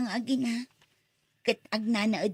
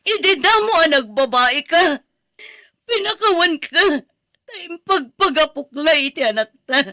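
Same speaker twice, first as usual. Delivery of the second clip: 높고 큰 목소리로 외치는 분노; 용서했던 남편을 향해 터져 나오는 고함; furious shout; 5 semitones higher, 12 dB louder than usual. Acted. Wife yells at cheating husband.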